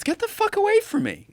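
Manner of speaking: very high, squealing mockery of the guy; way up high, hammed-up recoil; mock disgusted guy. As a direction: shriek it like a panicked prude. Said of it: "I lean in and goes".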